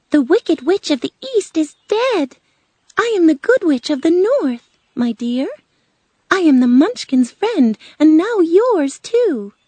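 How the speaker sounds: high, loud, proud storybook witch; friendly Good Witch. Acted. Good Witch introduces herself to Dorothy, friendly and proud. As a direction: introduce yourself grandly in a high voice.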